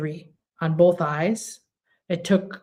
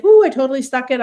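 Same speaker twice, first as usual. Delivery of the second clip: higher, louder little victory whoop; mock triumphant cheer; 6 semitones higher, 7 dB louder, much faster than usual. Speaker cheers her own tiny win, playfully triumphant. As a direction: cheer yourself on for walking downstairs. Celebrating a small daily win.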